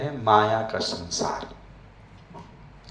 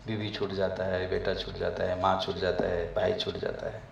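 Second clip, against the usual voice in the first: wistful; 7 semitones lower, 11 dB quieter than usual. Real, not acted. Leaving family at the airport.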